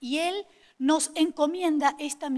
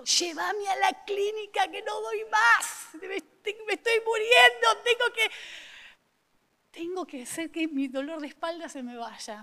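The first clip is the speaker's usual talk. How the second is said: higher, pained begging, louder on muriendo; desperate plea; 7 semitones higher, 4 dB louder than usual. Retold or imitated. Wife pleading with husband at dawn, desperate pain. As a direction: beg like you can't stand the pain.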